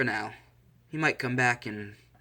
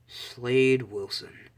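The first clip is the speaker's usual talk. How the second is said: ominous recognition; 4 dB louder, much slower than usual. Acted. Recognises old enemy's call.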